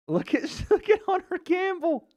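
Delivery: high, laughing exclaim; laughing disbelief. Real, not acted. Streamer laughing at the fighter on screen, high laughing exclaim. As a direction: crack up pointing at him.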